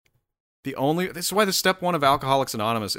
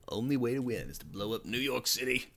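much softer, put-on dramatic voice; hushed movie line; 9 dB quieter than usual.

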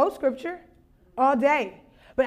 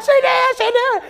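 high, loud disbelieving yell; realising the friend was right, yelling high; shocked scream; 9 semitones higher, 6 dB louder than usual. Retold or imitated. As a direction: scream it in disbelief, much higher. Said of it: "we're just screaming".

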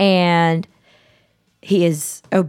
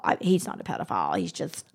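ordinary talk

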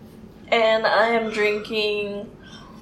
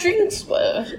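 fancy butler; 10 semitones higher than usual. Pretend. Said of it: "Sounds like a fancy butler".

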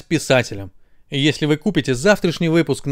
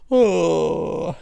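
a long lazy yawn; the unsure mouth yawning instead of answering; drawn-out yawn. A narrator's reading. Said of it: "решил просто зевнуть".

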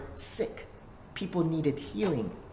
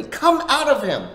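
a firm, raised order; stern command; 12 semitones higher, 11 dB louder than usual. Acted. Jesus sternly ordering the demon out, raised voice. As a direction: command the spirit to leave. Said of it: "He says sternly".

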